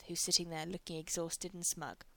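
plain reading voice

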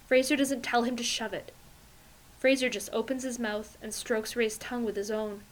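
ordinary delivery, nothing marked

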